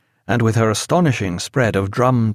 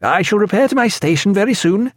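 calling after; 9 semitones higher than usual. A narrator's reading. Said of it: "calling after him".

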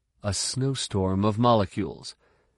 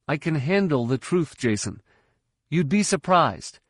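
put-on higher, protesting young voice; insistent pupil; 7 semitones higher than usual. A narrator's reading. Pupil who hates being humored by mentor, insistent. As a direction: insist on it, pitched up.

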